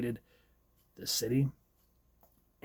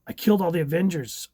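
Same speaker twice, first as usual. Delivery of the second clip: higher, louder, fast horrified rush; frantic realization; 5 semitones higher, 5 dB louder, faster than usual. Acted. Wanda realizing she killed them, rushing in horror. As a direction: blurt it out in horror.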